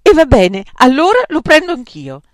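high, loud outburst; exasperated, emphatic decision; defiant surrender. A narrator's reading. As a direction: give in with a defiant shout. Defiant giving in to temptation.

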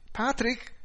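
high, bright call to someone in another room. A narrator's reading. Wife calls husband as she comes in, calling out high. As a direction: call the name out, pitched well up. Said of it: "chiamò".

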